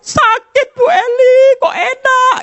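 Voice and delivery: high, loud mock sob to Bu Eli; hammed-up weepy cry. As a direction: fake a tearful wail. Mock wailing at congregant.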